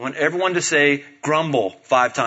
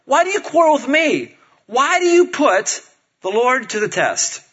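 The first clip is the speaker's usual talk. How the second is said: high, indignant questioning; exasperated challenge; 7 semitones higher than usual. Acted. Moses replies to quarreling people, exasperated challenge. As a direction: challenge them back, pitched up. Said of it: "Moses replied".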